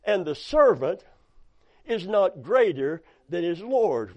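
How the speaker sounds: ordinary delivery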